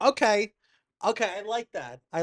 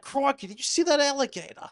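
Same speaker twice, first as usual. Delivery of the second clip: higher, hammed-up Australian accent; a big cartoon Australian, Crikey style; 9 semitones higher than usual. Shown as a demonstration. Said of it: "Australian accents are, like, easy to do".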